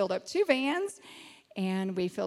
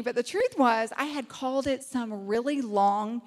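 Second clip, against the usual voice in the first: usual speaking voice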